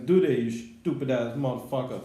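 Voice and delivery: ordinary delivery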